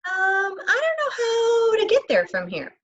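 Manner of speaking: put-on high, small, lost-boy voice; feigned innocence. A narrator's reading. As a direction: play the helpless new kid. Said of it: "Now he had to be crafty".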